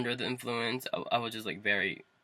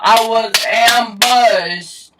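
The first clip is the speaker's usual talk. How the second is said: very slow, high, loud holler; drawn-out yell; 9 semitones higher, 12 dB louder, much slower than usual. Real, not acted. Telling viewers about the parents' ambush, drawn-out yell. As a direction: stretch AMBUSHED into a dramatic yell.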